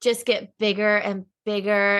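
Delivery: ordinary delivery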